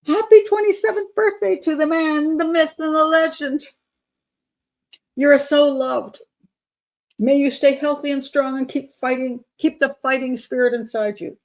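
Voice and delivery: high, gushing fangirl read; hammed-up devotion, sung out like a tribute; mock adoring fan. Mockingly reads adoring supporter's birthday message.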